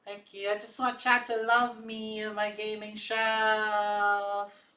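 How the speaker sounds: needy little character. Pretend. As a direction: plead for love in a high cartoonish voice. Plays a needy puppet-like character.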